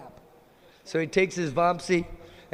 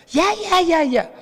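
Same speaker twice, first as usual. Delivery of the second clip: very high, loud squeaky chorus of yeah; squealing friends urging Radharani on; pushy girlfriends; 11 semitones higher, 8 dB louder than usual. Acted. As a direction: egg her on like giddy teens. Girls urge her again.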